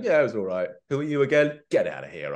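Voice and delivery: dismissive. Pretend. Acting a dismissive fan.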